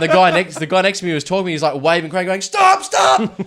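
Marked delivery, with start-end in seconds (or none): 2.4-3.4 high, loud warning shout; urgent yell. Retold or imitated. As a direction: re-enact a workmate's frantic holler. Retold warning yell by workmate.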